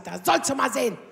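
patient mocking her doctor after losing no weight, sarcastic; sarcastic echo. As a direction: hand his promise back to him with scorn. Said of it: "Ich sag".

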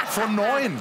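high laughing admission of full marks. Real, not acted. Guest laughing as he confesses his test score. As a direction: laugh it out, half proud, half embarrassed. Admits scoring nine of nine.

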